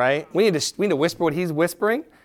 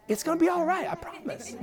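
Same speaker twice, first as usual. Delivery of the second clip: high, soft voice near laughter; playful reassurance; 11 semitones higher, 7 dB quieter than usual. Pretend. Preacher telling overwhelmed audience it's all right, playfully reassuring. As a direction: comfort them in a light high-pitched voice. Comic reassurance after serious one.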